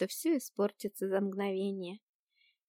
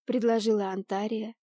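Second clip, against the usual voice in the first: ordinary delivery, nothing marked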